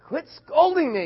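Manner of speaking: loud protest. Retold or imitated. Boy tells grandma off for scolding, high loud protest. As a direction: push back at the telling-off.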